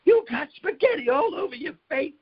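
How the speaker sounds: high, laughing taunt; laughing tease. Retold or imitated. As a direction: point and laugh through the line. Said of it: "And he's like".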